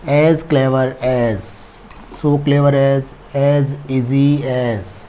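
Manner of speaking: slow, spelled-out teacher dictation. Shown as a demonstration. Teacher slowly dictating forms for the class to note down. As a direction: pace each word out for students to copy. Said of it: "degree of comparison में".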